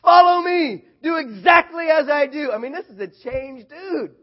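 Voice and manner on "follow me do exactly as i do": much higher and louder, rallying the troops; full-throated call to follow; commanding battle shout. Retold or imitated. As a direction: bellow it like a leader charging out. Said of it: "You hear what he says?".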